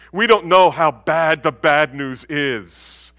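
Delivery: ordinary talk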